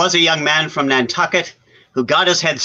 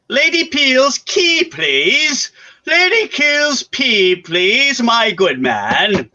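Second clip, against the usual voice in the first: high, slow indignant grand dame; indignant grand lady; 7 semitones higher, slower than usual. Retold or imitated. Friend mimicking Lady Peel demanding her key, high and slow. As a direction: play the affronted aristocrat at the desk. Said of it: "you said rather indignant".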